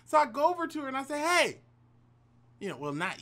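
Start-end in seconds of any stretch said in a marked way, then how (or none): 0.1-1.6 eager approach. Retold or imitated. Approaching her after church.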